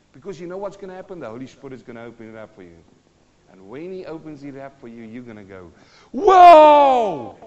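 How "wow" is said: huge, high-pitched "Wow!"; booming acted astonishment; amazed shout. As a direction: explode with exaggerated revelation. Acts listener's moment of revelation.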